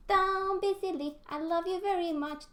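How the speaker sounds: put-on very high sing-song girl voice; sweetly nosy little sister; Dee Dee voice. Dee Dee asking her brother what he has, very high. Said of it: "I love Dee Dee".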